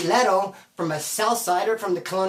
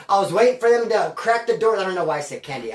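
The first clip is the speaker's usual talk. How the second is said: usual speaking voice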